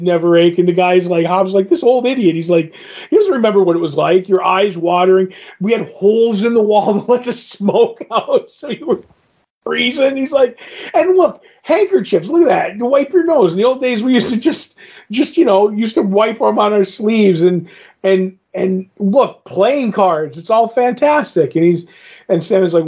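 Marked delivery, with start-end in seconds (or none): 1.7-2.4 higher, lightly voiced scoff; hint of a grumpy drinker; mock scoffing commoner. Hobbs scoffing at old man. 3.1-21.5 higher voiced, lively mock rant; lightly voiced excited commoner; hammed-up old drinker rant. Hobbs ranting about old days.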